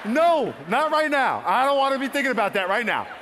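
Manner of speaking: high, loud protest; retold shouted refusal to God; defiant refusal.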